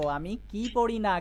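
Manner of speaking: slow, with কি stretched out; stress on কি. Shown as a demonstration. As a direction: hit কি firmly, ease off after it. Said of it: "কি শব্দের উপরে জোর দিয়ে বলবো".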